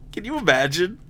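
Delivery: laughing. Real, not acted. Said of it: "laughing while talking".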